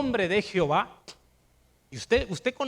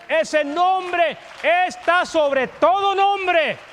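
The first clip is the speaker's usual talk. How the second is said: builds to a higher, louder shout, slow at the peak; preaching shout; 8 semitones higher, 7 dB louder, much slower than usual. Real, not acted. Preacher proclaiming Jesus' name over every name, climbing shout. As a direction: proclaim the name at full force.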